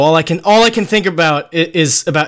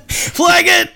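laughing; 12 semitones higher than usual. Real, not acted. Echoing a guest's joke.

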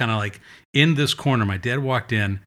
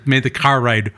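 ordinary talk

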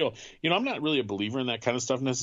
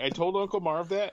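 normal talking voice

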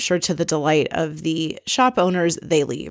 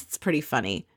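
normal talking voice

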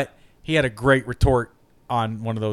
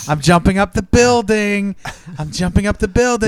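excited; 5 semitones higher, 3 dB louder than usual. Pretend. Playing Daredevil in action.

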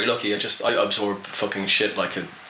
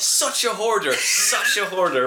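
mock gossip; 8 semitones higher, slower than usual. Mocks gossip about himself as hoarder.

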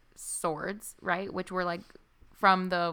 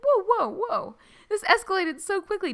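mock alarmed; 11 semitones higher than usual. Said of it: "I'm like".

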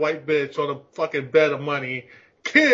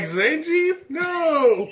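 mock terrified yell; 10 semitones higher, much slower than usual. Mock horror at Zangief.

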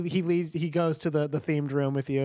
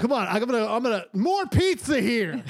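character voice; 7 semitones higher than usual. Acted. NPC invites for pizza.

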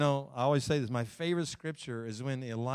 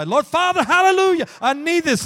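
high, loud crying-out prayer; fervent shouted prayer; 17 dB louder than usual. Acted. A worshipper after years of waiting crying out loud to God. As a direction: shout it up to heaven in desperation. Another year goes by.

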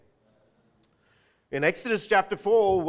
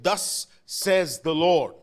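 slow, loud prophetic declaration; 5 dB louder, much slower than usual. Shown as a demonstration. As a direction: proclaim it like a prophet. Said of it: "say it like a prophet would say it".

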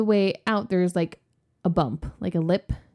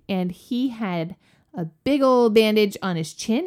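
ordinary talk

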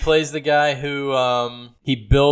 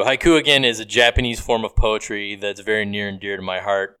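normal talking voice

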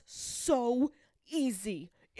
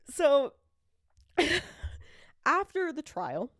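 ordinary talk, nothing marked

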